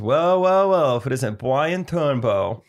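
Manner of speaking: drawn-out sly "well, well, well" starting high and loud; slow theatrical "if it isn't Brian Turnbow"; mock villain greeting. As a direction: greet him like a gloating villain. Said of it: "why'd you say it like that".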